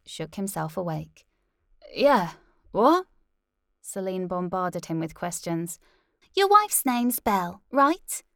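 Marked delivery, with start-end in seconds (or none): 1.9-3.1 startled. Acted. Jolted awake answer. 6.3-8.2 bright host voice. Acted. TV host questioning.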